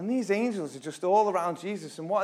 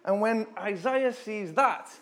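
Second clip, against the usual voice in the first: ordinary talk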